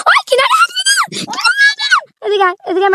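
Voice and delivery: very high yell to get back from the edge; frantic shout. Real, not acted. Vlogger yells at a friend near the cliff edge, frantic shout. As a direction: scream a warning, come away now.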